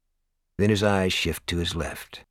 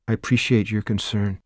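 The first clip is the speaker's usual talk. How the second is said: robot voice; 2 semitones higher than usual. A narrator's reading. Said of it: "in that same polite robot voice".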